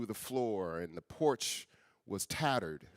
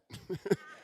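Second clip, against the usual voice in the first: short high laugh; 3 dB quieter than usual. Real, not acted. Speaker laughs at his own puzzlement, a short high laugh. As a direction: laugh at yourself lightly.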